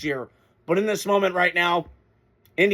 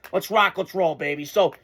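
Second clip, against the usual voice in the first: normal talking voice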